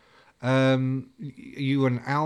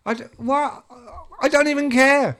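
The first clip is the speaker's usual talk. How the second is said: baffled thought; 10 semitones higher, 5 dB louder, faster than usual. Retold or imitated. Retold inner thought, baffled.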